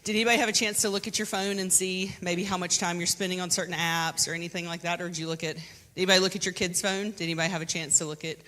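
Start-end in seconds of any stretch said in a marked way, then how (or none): none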